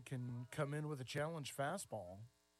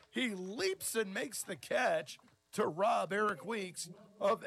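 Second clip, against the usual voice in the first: excited play-by-play; 6 semitones higher, 6 dB louder than usual. Real, not acted. Play-by-play call of a catch.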